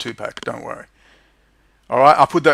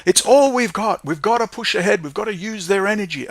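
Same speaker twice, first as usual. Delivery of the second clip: mocking imitation; 6 semitones higher than usual. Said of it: "People keep saying to me".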